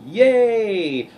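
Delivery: loud, high, drawn-out mock cheer; hammed-up "hooray" delivery; mock celebration. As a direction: cheer hugely for a measly prize. Sarcastic cheer at tiny tax refund.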